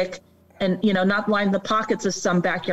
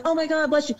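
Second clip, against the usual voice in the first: high-pitched imitated gushing; mock adoration; 8 semitones higher, faster than usual. Stranger blessing someone for a rescue, mock adoration. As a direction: gush in a much higher voice, imitating a fan. Voices somebody adoring a rescuer.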